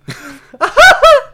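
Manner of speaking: high, loud cackling laugh; laugh impression. Shown as a demonstration. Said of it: "Is it me?".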